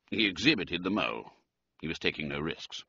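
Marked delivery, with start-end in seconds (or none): none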